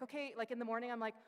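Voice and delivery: ordinary talk, nothing marked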